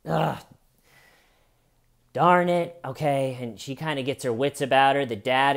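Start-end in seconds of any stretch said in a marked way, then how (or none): 0.1-3.4 flustered mom. Retold or imitated. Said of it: "The mom now is sitting there going".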